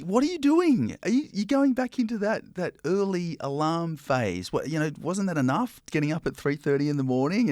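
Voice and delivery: incredulous friends. Retold or imitated. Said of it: "I've had people say to me".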